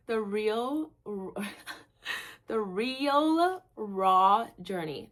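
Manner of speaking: real laughter tripping on real raw; laughing through it. Real, not acted. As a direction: fight the giggles to get the phrase out. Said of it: "Say that ten fucking times fast".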